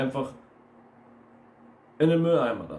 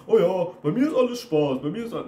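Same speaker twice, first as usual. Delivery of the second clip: higher, louder carefree parody; mock easygoing type; 5 semitones higher, 5 dB louder than usual. Coach mimics people who take everything as fun, mock easygoing. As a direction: imitate someone who takes everything lightly. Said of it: "Nehmen alles locker".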